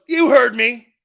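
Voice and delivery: loud, high, snapped coach imitation; barked retort. Coach to player who looked over, barked retort. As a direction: bark it so there is no arguing.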